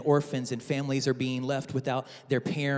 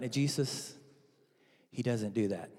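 normal talking voice

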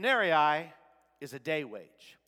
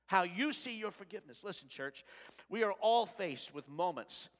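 ordinary talk, nothing marked

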